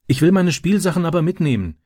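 put-on a boy who refuses to leave his toys behind; protesting. A narrator's reading. Boy begs his stepfather for his toys, protesting. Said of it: "protestierte Francis".

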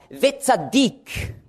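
"Wetzadik" said slowly as ve-tsa-DIK; Hebrew word. Shown as a demonstration. Preacher teaching the first Hebrew word, careful Hebrew. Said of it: "Das erste Wort heißt".

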